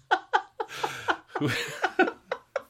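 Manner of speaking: high, helpless giggling; can't stop giggling. Real, not acted. Giggling fit keeps going.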